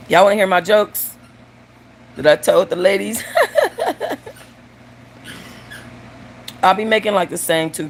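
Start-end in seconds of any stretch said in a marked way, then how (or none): 3.3-4.3 high, rapid run of giggles. Real, not acted. Laughing about jokes told the ladies, high giggle. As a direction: giggle helplessly at yourself. Laughs at her own joke habit.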